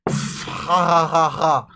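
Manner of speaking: snorting into a giggle; laughter fit winding down. Acted. Dave still cracking up at his selfies, laughing. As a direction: let the laugh leak out. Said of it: "Why can't I stop laughing?".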